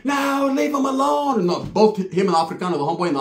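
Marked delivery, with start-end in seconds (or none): 0.0-1.4 slow high falsetto plea; pleading woman. Retold or imitated. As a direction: drag it out high like the girlfriend. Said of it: "the chick was like".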